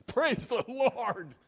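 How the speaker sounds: high, slow, drawn-out joyful cry; excited praise. Retold or imitated. Preacher imitates the excited psalm writer, high and slow. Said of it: "He was so excited".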